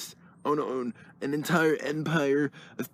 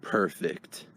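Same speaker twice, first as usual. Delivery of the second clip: normal talking voice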